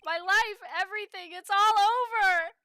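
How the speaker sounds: loud anguished wail; wailing despair. Acted. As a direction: wail that it's all over.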